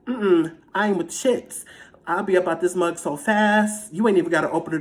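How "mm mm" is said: ordinary delivery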